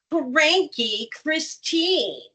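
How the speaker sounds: slow, grumpy drawn-out name; cranky. Shown as a demonstration. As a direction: say it like you're cranky. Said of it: "kind of become the emotion".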